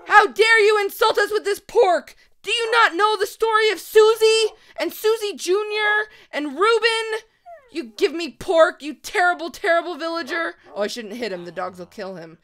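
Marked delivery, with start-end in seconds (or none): 0.1-10.5 hammed-up indignant shout, high and loud; mock outrage. Gamer scolding a villager over pork, hammed-up fury. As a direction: play scandalised at the insult.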